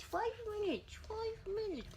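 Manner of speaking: mock indignant. Real, not acted. Teasing jealous dog.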